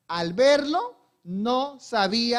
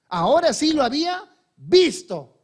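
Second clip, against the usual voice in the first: higher and louder, with building joy; preacher proclaiming her sight restored; rising excitement; 6 semitones higher, 7 dB louder than usual. Acted. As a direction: let it burst out as good news. Climax of the recognition.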